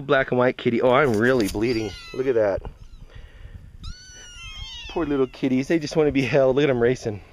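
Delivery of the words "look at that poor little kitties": ordinary delivery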